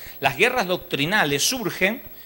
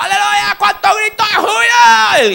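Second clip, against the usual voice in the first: high, loud hammed-up cry of joy; mock joyful shout; 11 dB louder than usual. Parodying the congregation's jubilant shouting, high and loud. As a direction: shout it like an overexcited worshipper. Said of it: "porque están todos".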